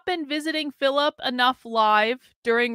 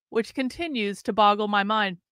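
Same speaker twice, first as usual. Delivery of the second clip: ordinary talk, nothing marked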